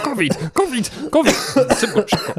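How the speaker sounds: high, frantic mock alarm. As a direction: cry out in playful panic. Mock alarm about covid.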